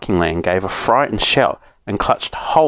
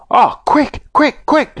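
much higher, frantic; urgent shout; slower than usual. A narrator's reading. Joe urging the saucepan man, urgent shout. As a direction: hurry him along. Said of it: "shouted Joe".

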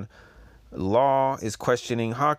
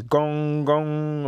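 gong sound effect; 5 semitones higher, 4 dB louder than usual. Acted. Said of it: "It says".

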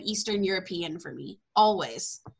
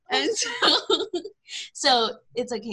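normal talking voice

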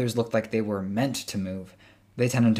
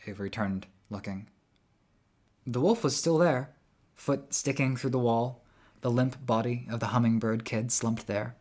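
ordinary delivery, nothing marked

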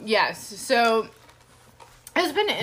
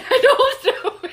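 laughing, much higher; giggling through the words; 5 dB louder than usual. Real, not acted. Laughs embarrassed.

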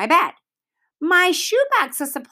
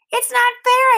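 put-on high, indignant boy's voice; upset protest; 13 semitones higher, 5 dB louder than usual. A narrator's reading. Peter tells Sheila it isn't fair, high and indignant. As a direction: protest it loudly to her.

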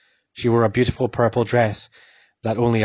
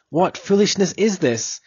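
scornful anger; 8 semitones higher than usual. Acted. Officer scorns the prisoner.

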